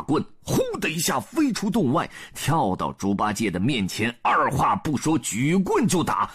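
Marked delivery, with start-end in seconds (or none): none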